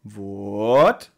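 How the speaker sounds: "What?" with a bridge-like rise and fall in pitch; questioning what. Shown as a demonstration. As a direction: say "What?" with an arched tune. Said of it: "Betonungsart ist, dass man quasi sagt".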